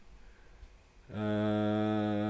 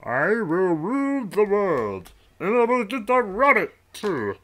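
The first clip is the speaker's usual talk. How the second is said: megalomaniac rant; 12 semitones higher, 8 dB louder than usual. Pretend. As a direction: belt it out like a cartoon villain. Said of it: "I can't get the voice down".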